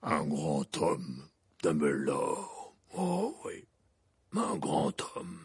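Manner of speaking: low, slow half-giant voice; deep, sorrowful giant; Hagrid voice. A narrator's reading. Hagrid answers Dumbledore, low slow giant voice.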